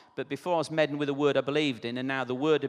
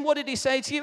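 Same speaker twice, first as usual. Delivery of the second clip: high-pitched nosy passenger voice; imitated puzzled onlooker; acted bystander; 9 semitones higher, 3 dB louder, faster than usual. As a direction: ask it high, as the curious mate. Second van passenger asks, acted out.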